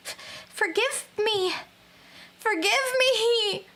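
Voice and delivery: high, stammering plea, slowing on the second; a terrified girl begging the witch to forgive her; pleading victim. Acted. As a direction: beg for mercy, voice rising.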